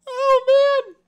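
very high, loud squeal of amusement; helpless laughter. Real, not acted. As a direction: wail it out, overcome with laughing. Still cracking up over rolls.

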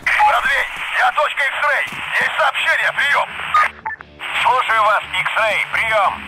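urgent radio call; military radio. Acted.

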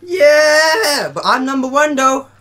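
high, loud triumphant yell; excited shout. Real, not acted. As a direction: cheer it out like a winner. Friend named him top buddy.

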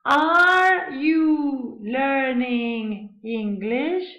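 very drawn-out words, rising at the end; slowly modelled example question; slow teaching model. Shown as a demonstration. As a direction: stretch every word out for learners.

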